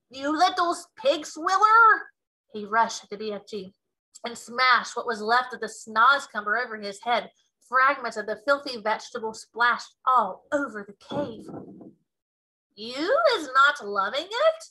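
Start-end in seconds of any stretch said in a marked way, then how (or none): 0.1-2.1 high, loud, slow giant roar; roaring insult. Acted. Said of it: "roared the Bloodbottler". 12.7-14.6 innocent question. Acted. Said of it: "the BFG asked innocently".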